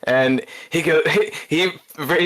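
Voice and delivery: usual speaking voice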